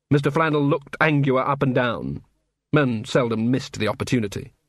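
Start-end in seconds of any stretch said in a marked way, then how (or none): none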